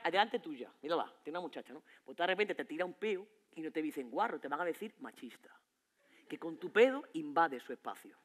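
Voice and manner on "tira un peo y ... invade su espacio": whispered aside. Acted. Comic whispers the punchline to the crowd. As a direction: hush it like a secret.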